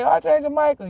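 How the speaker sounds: rufend, hoch und laut: "Archangel Michael"; calling out loud. Retold or imitated. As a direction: call "Archangel Michael" out across the yard. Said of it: "and I say".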